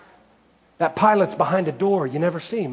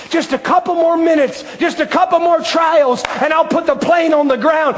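high, loud, building sermon climax; a preacher rousing the congregation to trust Jesus; full-force preaching; 9 semitones higher, 4 dB louder than usual. Acted.

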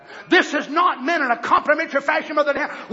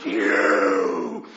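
mock sneering accusation; 3 semitones higher, 4 dB louder, much slower than usual. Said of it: "they said".

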